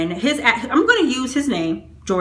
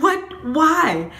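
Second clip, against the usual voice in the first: high What, then a puzzled Why; baffled by the betrayal; confused disbelief; 7 semitones higher than usual. Retold or imitated. Reacting to the friend's lies, high confused disbelief. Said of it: "I'm like".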